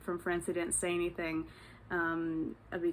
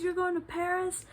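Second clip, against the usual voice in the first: imitating an excited stranger, much higher pitch; hyped-up disbelief; 11 semitones higher than usual. Fellow traveler hears about the Paris trip, imitated excitement. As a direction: echo it back as a high, amazed question. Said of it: "we were hyping each other up".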